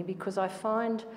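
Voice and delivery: usual speaking voice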